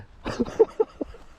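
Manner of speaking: high breathy giddy laugh. Real, not acted. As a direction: laugh out the excitement.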